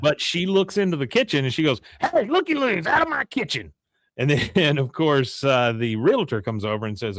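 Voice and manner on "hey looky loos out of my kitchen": high-pitched scolding holler; hammed-up indignant homeowner; mock shooing yell. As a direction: order snoopers out in a high voice. Retells woman ordering snoopers out.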